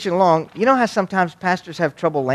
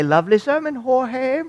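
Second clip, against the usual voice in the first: high mock British greeting; mock British pleasantry, lifting at the end; British accent; 8 semitones higher than usual. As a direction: put on a high-pitched, silly British accent. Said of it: "we always spoke British".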